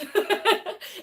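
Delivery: high, bright burst of laughter; real laugh. Real, not acted. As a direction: laugh at yourself. Laughing at her own story.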